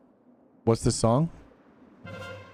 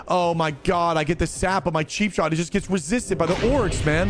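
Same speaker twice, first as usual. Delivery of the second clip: whiny player; 5 semitones higher than usual. Retold or imitated. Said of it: "then guess what's gonna happen?".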